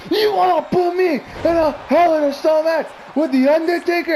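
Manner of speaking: very high, frantic mimicked scream; screamed heel impression. Fan imitating Edge's old heel promo, screaming hysterically. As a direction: shriek it like an unhinged wrestling villain.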